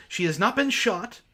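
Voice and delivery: normal talking voice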